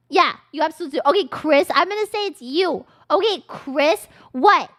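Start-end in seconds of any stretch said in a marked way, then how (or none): none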